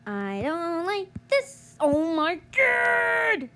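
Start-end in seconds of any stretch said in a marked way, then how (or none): 1.8-3.5 long, slow, high wail of despair; drawn out shout. Real, not acted. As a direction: stretch it out in exasperation. Gamer frustrated by game.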